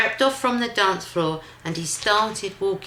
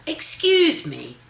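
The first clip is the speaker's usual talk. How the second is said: polite cricket; 10 semitones higher, 3 dB louder than usual. Acted. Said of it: "coughed a cricket".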